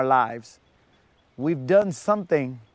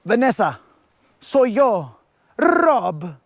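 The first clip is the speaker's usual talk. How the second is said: higher, hammed-up telenovela declaration; dramatic novela lover; 5 semitones higher, 3 dB louder, slower than usual. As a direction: ham it up like a soap-opera hero confessing. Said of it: "Did you do it all dramatic like a, like a novela".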